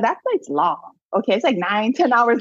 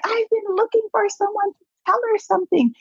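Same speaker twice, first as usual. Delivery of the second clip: high, insistent retold plea; urgent pleading; 13 semitones higher than usual. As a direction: imitate someone desperate to pass on a message. Husband urgently explains his search.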